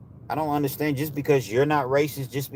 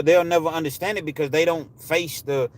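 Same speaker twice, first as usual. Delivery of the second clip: usual speaking voice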